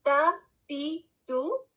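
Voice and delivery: تا تی تو said one by one, light thin t; ta with vowels. Shown as a demonstration. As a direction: run the vowels on تا تی تو. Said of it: "اگر ہم اس کو ادا کر کے دیکھیں".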